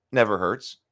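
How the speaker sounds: ordinary talk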